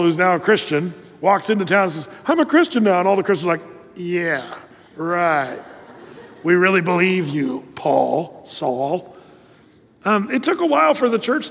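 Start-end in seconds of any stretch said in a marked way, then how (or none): 2.3-3.0 higher, chirpy mock proclamation; mock eager announcement. Newly converted Paul to the Christians, high mock-eager announcement. 4.0-9.2 low, dry mock agreement; sarcastic disbelief. Doubting Christians answering converted Paul, low sarcastic disbelief.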